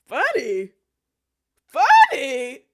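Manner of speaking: loud, incredulous repeat; indignant outburst. Retold or imitated. Throwing his word back at the man, loud and incredulous. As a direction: blast the word back at him.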